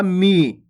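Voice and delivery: मी held out as a long ee; drawn-out vowel. Shown as a demonstration. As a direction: stretch the i of मी long. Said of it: "अगर खींच के करोगे".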